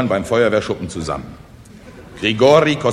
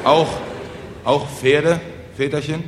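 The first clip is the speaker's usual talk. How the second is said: halting question with rising end; anxious; 5 semitones higher than usual. Acted. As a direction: falter after the first word, dreading the answer. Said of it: "fragten ängstlich die Bauern". (ängstlich stockende Frage)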